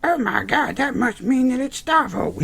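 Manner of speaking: mocking high-pitched falsetto; Emma. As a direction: squeal it in a mock falsetto. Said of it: "Emma would just be like".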